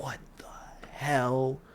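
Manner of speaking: slow, breathless whisper of shock; awed whispered disbelief; stunned whisper. Retold or imitated. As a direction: hush it out, too stunned to voice.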